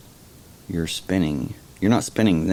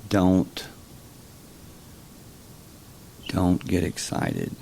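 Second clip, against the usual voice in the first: level and even, no rise or fall; monotone; 4 dB quieter than usual. Shown as a demonstration. Speaker showing listeners a monotone command, level and even. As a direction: say it with no change at all. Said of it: "very monotone".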